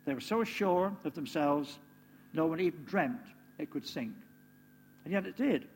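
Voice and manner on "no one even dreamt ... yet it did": ordinary talk, nothing marked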